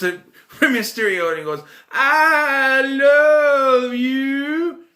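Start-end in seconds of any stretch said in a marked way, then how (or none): 1.9-4.8 high, slow, hammed-up lovestruck voice; mock tender confession. Wrestler swooning at Rey Mysterio in bathroom, hammed-up tender confession. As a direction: stretch it out like a swooning admirer.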